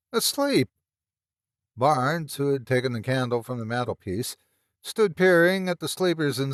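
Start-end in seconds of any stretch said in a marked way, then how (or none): 0.1-0.7 sudden much higher cry; the word bursts out in horror; horrified cry. Acted. Horrified outburst after stammer.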